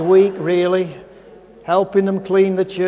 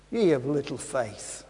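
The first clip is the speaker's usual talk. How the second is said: quiet rebuke; 10 semitones lower, 10 dB quieter than usual. Acted. Jesus rebukes the disciples.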